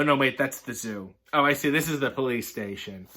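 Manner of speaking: ordinary delivery, nothing marked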